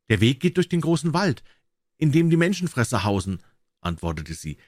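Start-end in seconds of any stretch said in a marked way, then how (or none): 0.1-3.4 higher maiden's voice, earnest; enchanted princess, warning. A narrator's reading. Princess warns drummer about the glass mountain, high and earnest. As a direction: warn him in a raised, earnest pitch.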